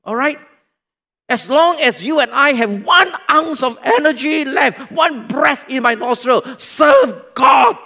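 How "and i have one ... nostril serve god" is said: loud, higher rallying cry; preaching at full force; shouted exhortation. Real, not acted. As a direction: call it out to the whole church.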